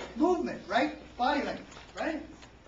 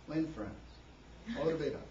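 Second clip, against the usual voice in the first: low, soft, listless pitch; sleep-inducing delivery; 9 semitones lower, 8 dB quieter, faster than usual. Shown as a demonstration. Speaker showing how to bore an audience, low and soft. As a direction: drop the energy out of each slogan. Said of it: "fall asleep".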